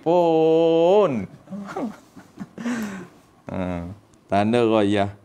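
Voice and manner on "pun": "pun" held long; drawn-out PUN. Shown as a demonstration. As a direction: pull the vowel of "pun" out slowly. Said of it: "kena tarik sikit".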